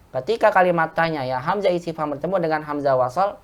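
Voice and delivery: normal talking voice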